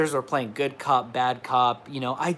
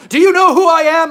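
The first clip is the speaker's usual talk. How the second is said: loud, high, haughty demand; mock entitled VIP; 12 semitones higher, 10 dB louder than usual. Mocking the entitled flex he denies making, loud haughty demand. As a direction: ham up a self-important celebrity. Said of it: "I'm not saying this trying to flex".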